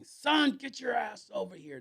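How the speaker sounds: high barked call across the room; hollering for his protégé like a father; gruff cowboy boss. Retold or imitated. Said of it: "That's how he talked to me".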